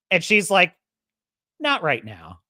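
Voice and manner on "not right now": low, curt mock refusal; dismissive brush-off. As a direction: imitate a character shutting the conversation down. Retold brush-off from show dialogue.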